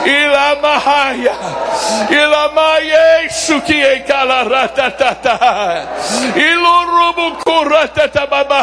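joyful laughing tongues; speaking in tongues. Acted. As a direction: let tongues bubble with joy.

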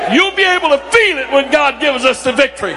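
preaching at full force. Acted. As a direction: pray it out with fire. Leads intense prayer at full force.

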